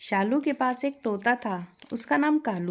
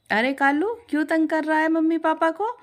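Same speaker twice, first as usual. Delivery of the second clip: higher, louder, quick mock scolding; playful scolding; 8 semitones higher, 5 dB louder than usual. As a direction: tick off the naughty parrot. Shalu scolds parrot. (प्यार से डाँटना)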